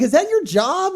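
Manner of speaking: imitating a baffled, judgmental worker; incredulous imitation. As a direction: scoff at the idea as a stunned worker would. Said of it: "He's like".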